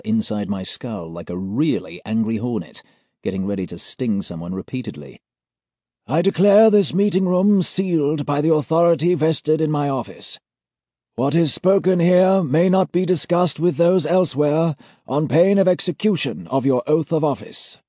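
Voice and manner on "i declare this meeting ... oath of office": put-on high, loud ceremonial old gent; formal old auditor. A narrator's reading. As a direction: pronounce the seal with cold authority. Senior auditor figure.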